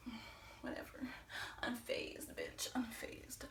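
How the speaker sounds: whispered cool indifference; unfazed. Retold or imitated. Customer facing a charging attacker, outwardly unfazed. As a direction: barely voice it, dismissive and unbothered. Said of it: "on the outside, I was just like".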